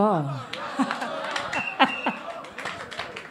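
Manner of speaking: long breathy laugh. Real, not acted. Judge amused by the nickname Maboko, long laugh. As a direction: laugh softly and at length.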